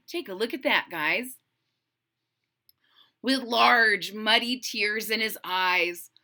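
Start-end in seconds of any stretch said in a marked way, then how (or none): none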